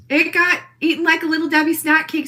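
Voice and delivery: ordinary talk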